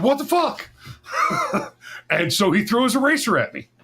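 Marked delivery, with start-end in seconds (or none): 0.0-0.7 high, strained squeal of disbelief; stunned outrage. Retold or imitated. Student hit by a thrown marker, high indignant squeal. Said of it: "And I said". 0.9-2.0 a high squeal of laughter; high squeaky laugh. Real, not acted. Storyteller laughing at his own teacher story, high squeal.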